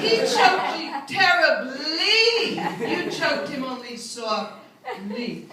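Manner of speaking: teacher drilling the adverb endings; didactic correction. Retold or imitated. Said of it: "You're not using your adverbs correctly".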